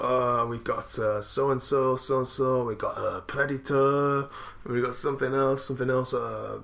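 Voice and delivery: drawn-out Italian Predator; Italian accent. Retold or imitated. Friend's dad listing films to lend, Italian accent. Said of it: "saying the word Predator in this Italian accent".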